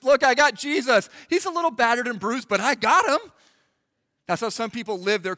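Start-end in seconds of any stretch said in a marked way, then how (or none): 0.0-3.3 loud, high, insistent claim; mock defensive protest. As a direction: plead your case at the gates, too loudly. Defensive believer at heaven's gates.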